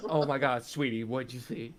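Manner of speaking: laughing, a touch of Southern sass; sassy Southern friend. Pretend. Friends improvising a Walmart gossip, sassy Southern voice. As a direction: gush back like a Southern gal.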